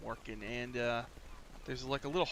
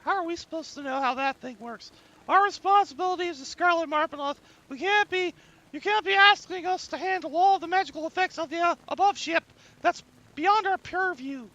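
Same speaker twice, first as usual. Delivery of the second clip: high-pitched, loud gnome protesting; squeaky-high gnome refusing the job; 11 dB louder than usual. Acted. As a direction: pitch it way up, indignant little gnome. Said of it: "and she goes".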